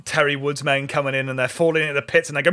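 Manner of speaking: ordinary talk, nothing marked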